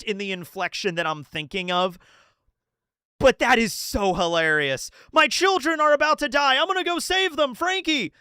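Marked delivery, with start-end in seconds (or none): 5.1-8.1 high, urgent heroic plea; desperate Ripley. Retold or imitated. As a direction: play Ripley bravely rushing off to save her children. Voicing Ripley's desperate farewell.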